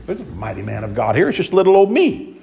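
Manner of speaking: high, incredulous disbelief; who, me? bewildered; surprised Gideon. Retold or imitated. Gideon doubting the angel, mock surprise. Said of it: "I can imagine Gideon, saying".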